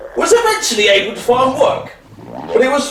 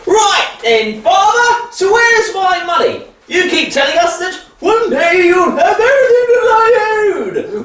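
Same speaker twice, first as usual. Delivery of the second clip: demanding son; 6 semitones higher than usual. Acted. Greedy son demanding his inheritance.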